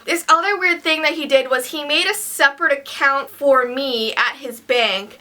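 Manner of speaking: usual speaking voice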